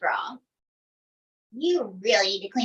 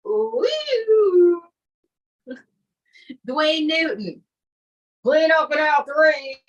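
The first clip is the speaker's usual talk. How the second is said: puppet voice; slower than usual. Acted. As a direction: croon it like a Vegas crooner. Ventriloquist puppet Dwayne Newton talking.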